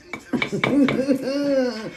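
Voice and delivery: soft, drawn-out giggle. Real, not acted. Admitting to adult beverages, soft drawn-out giggle. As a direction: giggle quietly and let it trail on.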